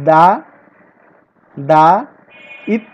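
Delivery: দা ইত তো split into separate beats; teacher sounding out দা ইত তো slowly; syllable by syllable. Shown as a demonstration. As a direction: break দায়িত্ব into দা, ইত, তো with pauses. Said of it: "সিলেবলটা আরো ভালো করে ভাগ করা যায়".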